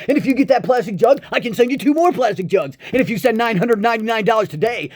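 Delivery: fast, high, loud sales pitch; TV pitchman. Pretend. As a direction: rattle it off like a TV ad huckster. Mock TV commercial pitchman.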